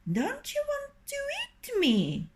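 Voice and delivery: high, wary rooster question. Acted. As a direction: ask like a curious rooster. Rooster asks fox warily.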